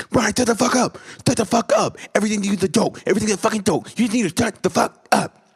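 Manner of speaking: very high-pitched, exploding mock rage; Lawrence snapping. Lawrence to Brian after too much miracle talk, high-pitched furious snap. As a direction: imitate a friend finally losing it, voice shooting way up. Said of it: "Lawrence, snapped".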